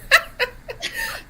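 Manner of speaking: sudden high laugh at herself. Real, not acted. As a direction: burst into a high embarrassed laugh. Laughs at her own stutter.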